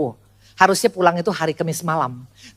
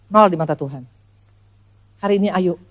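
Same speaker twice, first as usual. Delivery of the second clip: usual speaking voice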